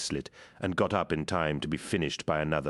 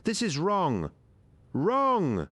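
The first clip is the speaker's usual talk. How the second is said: high, cracking cry of despair; 13 semitones higher, 3 dB louder, much slower than usual. A narrator's reading. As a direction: let the voice break on wrong. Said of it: "his voice cracking with despair".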